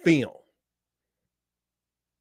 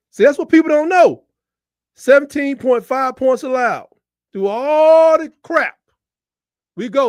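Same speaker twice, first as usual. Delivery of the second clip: fired up; 7 semitones higher, 8 dB louder than usual. Real, not acted. Fan ranting about team defense.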